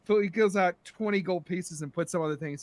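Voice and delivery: normal talking voice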